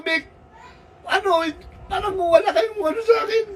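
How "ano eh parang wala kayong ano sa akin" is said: higher tearful complaint; acted crying. Parent on a call feeling uncared for, tearful. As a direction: plead as if breaking into tears. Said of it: "wag ka na umiyak naman".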